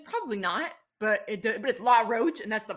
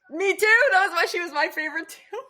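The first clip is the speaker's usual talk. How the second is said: much higher and louder, squealing; thrilled; 10 semitones higher, 8 dB louder than usual. Real, not acted. Host squealing she also loves [name]. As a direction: gush like a fan finding a fellow fan.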